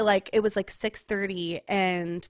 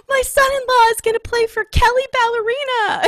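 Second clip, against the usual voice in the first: high, loud, hammed-up proud shout; mock-booming announcement, pitched way up; proud dad; 13 dB louder than usual. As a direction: imitate a dad bellowing his boast to the room. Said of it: "he's just so proud".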